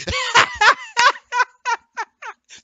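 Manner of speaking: big hearty laugh; loud laugh. Real, not acted. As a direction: burst out laughing loudly. Host laughs on air.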